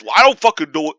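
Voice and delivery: loud, exasperated retort in imitation; mock angry dad. Dad asked which way to go, mock angry. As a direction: play an irritated dad, blurting it out.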